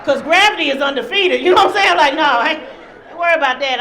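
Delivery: loud, high-pitched justification; emphatic comic protest. Acted. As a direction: protest loudly over the laughter. Comic protest to son over surgery.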